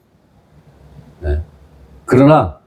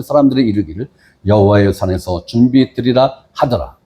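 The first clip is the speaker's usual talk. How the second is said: measured, lower Bible reading; scripture reading; 5 semitones lower than usual. Acted. Pastor reads Genesis to the congregation, solemn reading. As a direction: read the verses with solemn weight.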